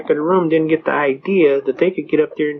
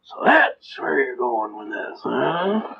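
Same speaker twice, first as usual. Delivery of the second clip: slow, softer cartoon double take; a loose cartoon-dad imitation, drawn out and lower; Homer Simpson; 2 semitones lower, 4 dB quieter, much slower than usual. Judge catching on to her move, hinting at a cartoon dad. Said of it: "The judge did a Homer Simpson".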